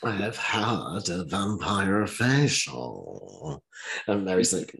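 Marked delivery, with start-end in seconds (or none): 0.0-3.6 very slow, drawn-out, deliberate; mock slow announcement. Meredith announcing her vampire facial, slow and drawn out. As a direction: spell it out word by word, a touch grand. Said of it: "Meredith's like".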